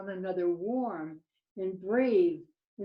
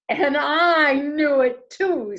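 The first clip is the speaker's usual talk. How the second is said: higher, louder little owl voice; raised, projected owlet voice; boastful owlet; 6 semitones higher, 9 dB louder than usual. Acted. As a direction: chime in proudly as a young owl. Voicing Percy owlet.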